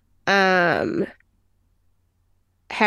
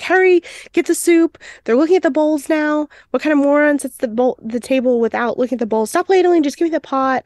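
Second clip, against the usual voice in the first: freaking out; 6 semitones higher, faster than usual. Retold or imitated. Armand frantically rushing the staff with the soup, a high hammed-up panic. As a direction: panic in the kitchen, hamming it up. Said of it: "he's freaking out".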